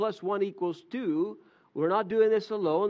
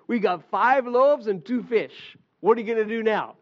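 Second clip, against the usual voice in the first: sarcastic; 4 semitones higher, 6 dB louder than usual. Retold or imitated. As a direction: let the disciples' sarcasm drip. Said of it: "a little bit of sarcasm in their answer".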